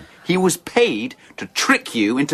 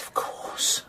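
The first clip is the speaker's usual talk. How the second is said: whispered under the breath; soft whisper of dawning understanding; whispered realization; 9 dB quieter than usual. Acted. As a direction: breathe it out as it dawns on him.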